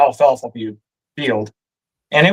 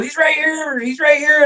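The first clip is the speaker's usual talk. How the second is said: excited call; 10 semitones higher than usual. Retold or imitated. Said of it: "My dad goes".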